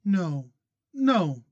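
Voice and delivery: narrator's light female voice for Kate; young woman's voice. A narrator's reading. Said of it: "Kate voiced by male narrator".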